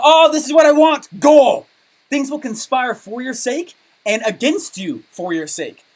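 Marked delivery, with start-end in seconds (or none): 0.0-1.7 loud, high mock shout of the goal; excited declaration. Coach acting out a student loudly declaring their goal. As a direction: shout out what you want, high and loud.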